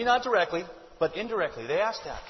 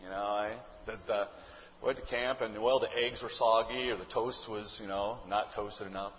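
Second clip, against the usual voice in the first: put-on unimpressed teen; 9 semitones lower, 5 dB quieter than usual. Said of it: "they respond with something like".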